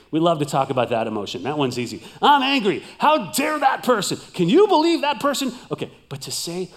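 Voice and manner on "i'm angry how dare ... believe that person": loud, high mock outrage; mock angry rant. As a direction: ham up the indignant man. Mocking men's angry talk.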